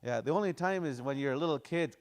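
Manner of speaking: ordinary delivery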